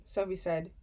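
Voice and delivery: ordinary delivery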